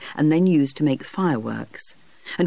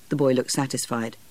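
plain reading voice

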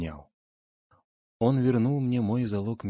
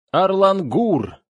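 long, high, full-force yell of a name; drawn-out shout, pitched far up; desperate cry; 11 semitones higher, 9 dB louder, much slower than usual. Acted. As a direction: bellow the name slowly over howling wind. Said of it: "Вопль Хрофта пробился сквозь бешеный визг".

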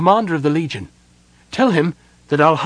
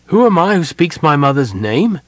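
high, loud impatient retort; 6 semitones higher, 6 dB louder than usual. A narrator's reading. Sylvan answers the challenger about his mother, impatiently. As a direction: throw the question back impatiently. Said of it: "Sylvan asked impatiently".

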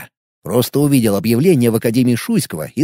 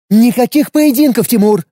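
curt refusal; 8 semitones higher, 4 dB louder than usual. Acted. Anya forbidding Timur the duel, sharp higher refusal. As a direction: cut it off sharply, higher and firm. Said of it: "отрезала Аня".

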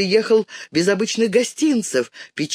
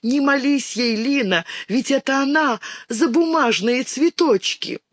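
pleading cry; 9 semitones higher than usual. A narrator's reading. Girl pleads with Lina.

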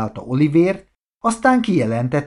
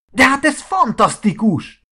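high, louder burst of delight; excited exclamation; 11 semitones higher, 4 dB louder than usual. A narrator's reading. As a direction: cry it out thrilled. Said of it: "kiáltott fel Marci".

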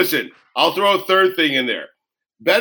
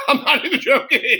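laughing, much higher; 11 semitones higher than usual. Real, not acted. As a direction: say it through laughter, pitch jumping up.

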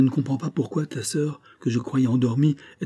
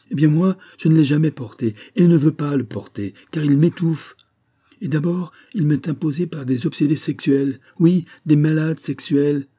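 the daughter's indignation, raised higher and louder; indignant outburst; 5 semitones higher, 4 dB louder than usual. Retold or imitated. Daughter rejecting the veil before her mother, raised and indignant. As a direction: fling each word back at the mother.

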